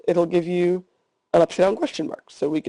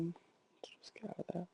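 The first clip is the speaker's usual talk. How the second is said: barely audible, whispered to oneself; whispered aside; 25 dB quieter than usual. Real, not acted. Teacher clearing the screen mid-tutorial, whispering to self. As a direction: whisper it as a passing aside while working.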